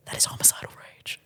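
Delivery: whispered aside; unvoiced dark aside. Real, not acted. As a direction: breathe it as a dark secret.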